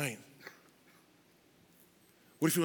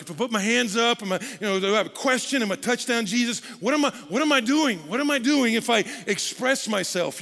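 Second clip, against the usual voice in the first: higher, louder nervous questioning; awkward self-conscious man; 7 semitones higher, 6 dB louder than usual. Retold or imitated. Man wondering about raising hands, high and awkward. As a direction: fumble through awkward doubts. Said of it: "You're like".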